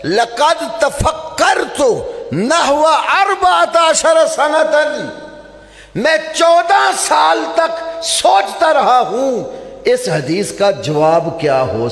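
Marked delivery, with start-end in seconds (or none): 0.1-9.6 raised preaching voice. Real, not acted. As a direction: thunder out the scholar's words to the crowd. Preacher quoting scholar, raised voice.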